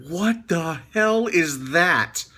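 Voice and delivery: mock-startled. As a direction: replay being creeped out. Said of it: "I was like".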